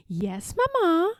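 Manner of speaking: put-on high, small child's voice; a girl answering her royal mother; little girl voice. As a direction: answer Mamma like a meek young girl.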